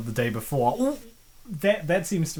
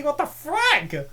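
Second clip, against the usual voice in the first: incredulous; 11 semitones higher, 3 dB louder, slower than usual. Real, not acted. Shocked at feeder family.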